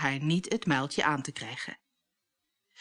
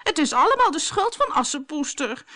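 high, louder nagging whine; a much higher, louder complaint; whining; 10 semitones higher, 5 dB louder than usual. A narrator's reading. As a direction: whine like a spoiled sister. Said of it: "zeurde Bertha".

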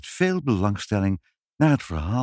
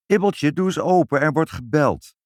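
calling out; 7 semitones higher, 4 dB louder than usual. A narrator's reading. Mother calling upstairs.

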